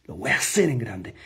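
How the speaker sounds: intense whispered promise. Retold or imitated. Salesman whispering his vow into the prospect's eyes. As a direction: breathe it with lion-eyed resolve. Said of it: "le dice".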